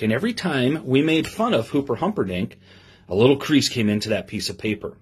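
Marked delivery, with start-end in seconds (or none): none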